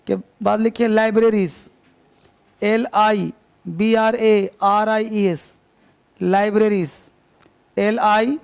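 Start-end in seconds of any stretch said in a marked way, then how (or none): 2.6-5.5 slow letter-by-letter dictation; teacher dictating letters; spelling out. Shown as a demonstration. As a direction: spell each letter slowly for students to write. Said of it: "teacher spelling out for dictation".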